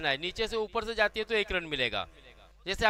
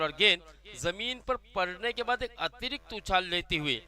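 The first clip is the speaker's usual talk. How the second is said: ordinary talk, nothing marked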